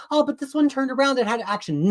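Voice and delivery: high, mocking quote; mocked online commenters. Critic imitating online defenders of the comic, mocking. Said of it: "comments by everybody online".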